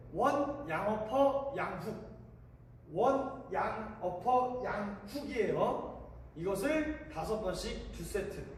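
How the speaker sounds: ordinary talk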